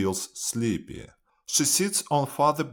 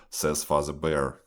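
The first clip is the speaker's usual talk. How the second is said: ordinary delivery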